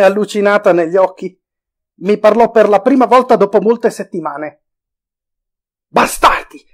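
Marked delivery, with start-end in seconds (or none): 5.9-6.6 loud angry cry, higher voice; shouted curse. A narrator's reading. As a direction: yell the insult at the enemy. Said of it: "gridò".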